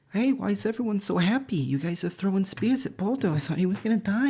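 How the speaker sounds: put-on faking innocence as a sweet old lady; Loki as old lady. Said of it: "uh, old lady".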